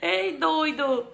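high laughing fit; laughing hard. Real, not acted. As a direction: crack up with laughter. Bursts out laughing.

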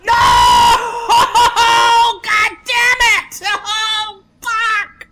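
drawn-out, very high loud scream; real gamer meltdown, screaming in agony at a loss; screamed despair. Real, not acted. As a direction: howl the curses slowly at full volume. Character dies in game.

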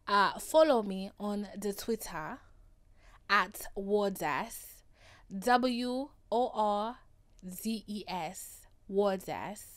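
mock African accent, spelling out the handle. Host plugs her Twitter in a playful mock African accent. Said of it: "my African accent just then".

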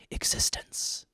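breathy whispered one-word tagline; dramatic ad whisper. Pretend. Perfume commercial tagline, dramatic whisper.

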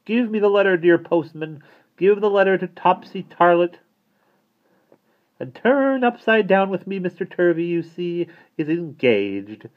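high, lilting lady's voice; coaxing lady. A narrator's reading. Miss Tarlett coaxes the postman.

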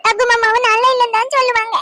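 put-on high, whiny little-kid voice; crying child. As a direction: complain tearfully like a small child. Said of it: "அழுவாத, என்ன?". (அழுதுகொண்டே பேசும் குழந்தைக் குரல்)

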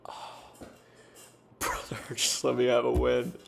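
exhausted sighing whisper into a laugh; weary whispered plea for a win; sighed breathy plea. Real, not acted. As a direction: breathe it out like you give up. Sighing plea after loss.

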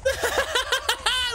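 high, giddy burst of laughter; gleeful cackle. Real, not acted. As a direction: laugh with glee at the damage. Laughing at Floki's blown-up roof.

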